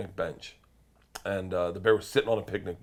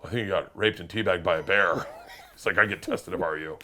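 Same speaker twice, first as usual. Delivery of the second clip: very dry; 4 semitones lower than usual. Retold or imitated. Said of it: "And just very dry, he goes".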